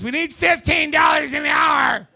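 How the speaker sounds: loud, high drawn-out demand; mock protest yell. Young worker demanding pay, mock yelling. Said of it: "yelling at us".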